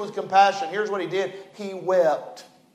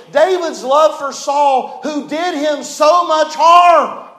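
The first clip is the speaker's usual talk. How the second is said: rising preaching cry; 6 semitones higher, 4 dB louder, slower than usual. Real, not acted. Preacher building to climax.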